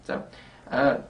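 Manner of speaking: ordinary delivery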